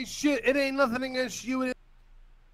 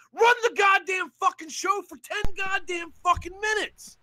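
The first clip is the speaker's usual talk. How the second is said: higher, shouted demand; furious shout; 7 semitones higher than usual. Real, not acted. Caller shouting at the rival to run the show, furious. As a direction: yell the demand at full force.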